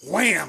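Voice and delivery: loud punched impact word; sudden sound effect for a hard crank; explosive wham. Retold or imitated. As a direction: slam it out in one burst. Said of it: "I talking about".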